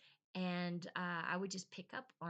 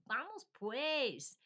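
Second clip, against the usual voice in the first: drawn-out, higher imitated whine; mock whiny; 6 semitones higher, 5 dB louder, slower than usual. Imitating in-laws when somebody was taking too long, whiny. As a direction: whine impatiently, stretching it out. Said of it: "kind of a little whiny thing to say".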